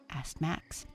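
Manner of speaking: usual speaking voice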